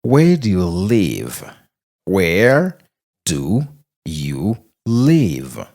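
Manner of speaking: ordinary delivery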